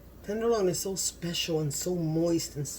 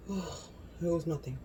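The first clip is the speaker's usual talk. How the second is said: usual speaking voice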